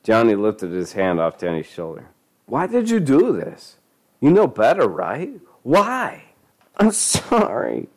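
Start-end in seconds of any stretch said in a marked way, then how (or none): 2.5-6.2 high pleading scold. Acted. Father scolding. 6.8-7.9 high, slow whine; whining. Acted. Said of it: "Denny whined".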